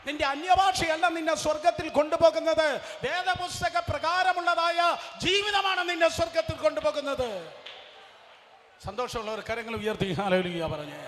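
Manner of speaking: high, loud pulpit shout; shouted preaching. Acted. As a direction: thunder it at the congregation. Preaching at full force.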